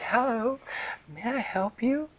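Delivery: high light fairy voice; gentle fairy. Acted. Dream fairy offering help to the pufferfish.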